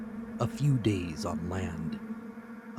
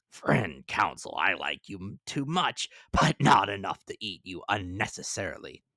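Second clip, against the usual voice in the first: Ned Land teasing; 4 dB louder than usual. A narrator's reading.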